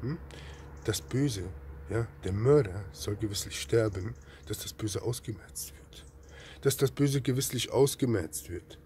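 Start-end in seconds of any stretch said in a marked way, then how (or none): 4.5-6.0 hushed, breathless whisper of the verse. Shown as a demonstration. Preacher whispers the verse to his listeners, then repeats it aloud. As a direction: let the verse drop to a bare whisper.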